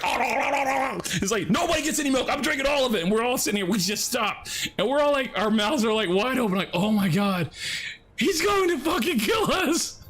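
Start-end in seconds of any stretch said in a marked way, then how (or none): 0.1-3.0 much higher, taunting no no no; a friend chugging the whole carton, gleefully refusing the others; gleeful refusal. Retold or imitated. 6.7-10.0 much higher, slow, stunned voice; stunned friends watching the big guy chug milk, in mock dread.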